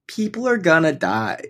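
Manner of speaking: slow, high-pitched imitation. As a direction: drag out a mocking celebrity quote up high. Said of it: "She goes".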